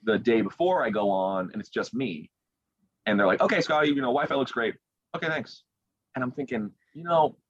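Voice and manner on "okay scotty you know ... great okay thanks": high-pitched, casual mimicry of crew; breezy staff voice. Show staff clearing a finalist after Wi-Fi check, breezy and high.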